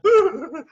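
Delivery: high, loud stuttering yelp; Cogsworth's frightened whimper. Acted. Servant with Beast in his face, frightened yelp. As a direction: yelp and trail away. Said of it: "and Cogsworth".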